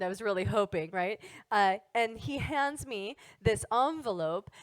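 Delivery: ordinary talk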